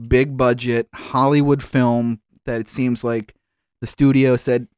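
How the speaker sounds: ordinary delivery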